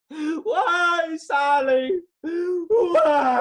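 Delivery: high, loud hammed-up wail; anguished cry. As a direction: cry to the heavens for Sally. Frank cries out for Sally.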